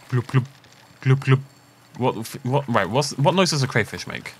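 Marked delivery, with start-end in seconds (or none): 0.1-1.4 playful fish gurgle noises; glubby fishy noises. Pretend. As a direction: blub like a little fish. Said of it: "make, like, glubby fishy noises".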